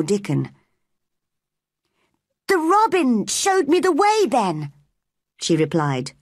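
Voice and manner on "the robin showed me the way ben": put-on high-pitched girl's voice; girl answering back. A narrator's reading. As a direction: answer the old gardener as a young girl. Narrator voices girl Mary.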